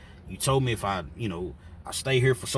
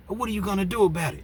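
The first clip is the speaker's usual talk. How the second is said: fast, high mocking challenge; taunting dare; 12 semitones higher, 4 dB louder, faster than usual. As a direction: goad him to swing first. John daring Omar to act.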